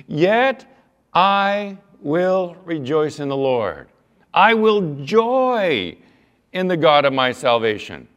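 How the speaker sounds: slow, loud, soaring proclamation; fervent preaching. Acted. Preacher proclaiming Habakkuk's vow of joy. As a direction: declare it like a triumphant vow. Preaching the verse at full force.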